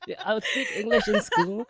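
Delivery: high, laughing through it. Real, not acted. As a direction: still giggling from the joke. Laughs after joke.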